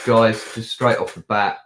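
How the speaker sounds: ordinary talk, nothing marked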